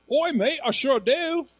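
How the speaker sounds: high, loud imitation of an Australian man; Australian accent. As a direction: play up a broad Australian accent. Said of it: "He was Australian. Could you tell?".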